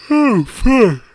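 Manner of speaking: a yawn swallowing the words. Real, not acted. Apologizing to viewers for yawning, a yawning curse. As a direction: let a yawn cut the words off. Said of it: "Not the story of me yawning".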